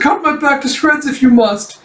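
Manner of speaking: high, loud, frantic acted plea; hammed-up terrified begging; mock screaming panic. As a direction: cry it out in pretend desperation. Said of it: "you hear him just screaming".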